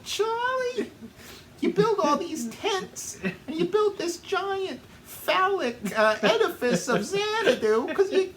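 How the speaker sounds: high squealing mock wife, laughing; mocking shrill woman voice. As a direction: shriek the insults in a cartoon wife voice. Mocking Charlie in a shrill put-on voice.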